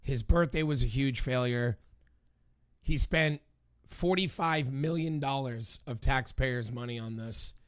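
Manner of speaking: ordinary delivery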